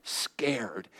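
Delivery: hushed, slow, scared confession; scared whisper. Acted. Pastor recalling sitting in the car, whispering slowly. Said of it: "I was really scared".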